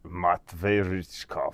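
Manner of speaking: low, slow Russian-accented name. Shown as a demonstration. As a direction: draw it out softly in a deep Russian accent. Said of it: "can I do a Russian accent?".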